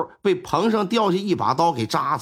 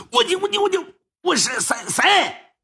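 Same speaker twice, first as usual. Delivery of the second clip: flustered stammer; 10 semitones higher than usual. Acted.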